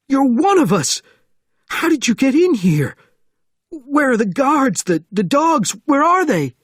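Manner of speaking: very high, loud, frantic questions; panicked shouting. A narrator's reading. Sergeant asking Bourne about guards and dogs, frantic shouting. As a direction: fire off questions in screaming panic.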